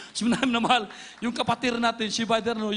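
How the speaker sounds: ordinary talk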